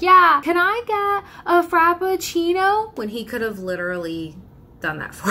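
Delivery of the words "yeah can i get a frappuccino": high, slow, far-off voice leaning over; girlfriend ordering. Retold or imitated. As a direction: stretch across the driver, calling the order high. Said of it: "to just be like".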